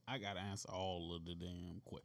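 slurred, low, tipsy mumble. Real, not acted. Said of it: "I sound like a drunk now".

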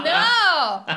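loud, high cry through laughter; laughing horrified protest. Pretend. Recoiling from the pee in the bunk, mock horror.

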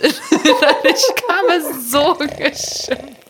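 lachend und gedehnt erzählt. Real, not acted. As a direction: lach dich durch den Satz, ganz langsam. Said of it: "Ich habe mich so geschimpft". (lachend, sehr langsam gesprochen)

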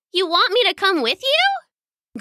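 high, curious little-princess voice; little girl. A narrator's reading. Little princess asking the cat at the gate, curious.

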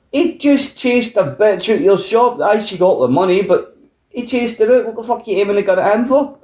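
high, outraged protest at the shopkeeper; indignant. Retold or imitated.

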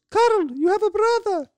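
put-on high, loud Hungarian-accented woman's voice; Hungarian mother. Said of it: "saying it like a Hungarian lady".

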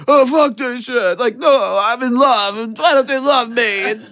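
put-on high drunken rant to herself; hammed-up drunk muttering. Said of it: "muttering and screaming to myself".

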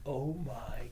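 slow, soft retold dismay; hushed disbelief. Storyteller reliving his realization while talking to roommate, hushed disbelief. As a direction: let it out slowly and quietly.

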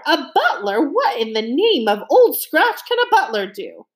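incredulous mock roar, pitched high; high, indignant outburst at BUTLER. A narrator's reading. Captain scoffing at a butler's usefulness, mildly roaring. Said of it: "the captain roared".

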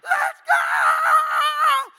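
loud stadium shout with a laugh; yelling together. Retold or imitated. Pastor and fan yell at each other, loud. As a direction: holler it, half laughing. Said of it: "we were just yelling at each other like".

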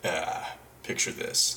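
low, slow, breathy-free husky intro; noir narrator setup. Retold or imitated. Podcast host setting the scene, low and slow. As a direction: ease into a brooding narrator. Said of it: "to get in the character now".

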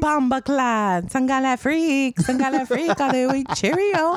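high, drawn-out mock Jamaican with British touches; hammed-up Jamaican patois ending in mock British; Jamaican-British accent. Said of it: "British accent, but I'll be Jamaican".

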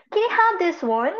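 drawn-out imitation of a British English speaker; mock British accent. Friend ordering at a restaurant, mock British accent. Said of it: "feeling British yan dahil sa Harry Potter".

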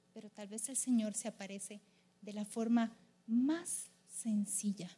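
slow, soft, low reflective delivery; quiet, intimate sermon voice; hushed preaching. Real, not acted. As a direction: dwell on each phrase with long pauses.

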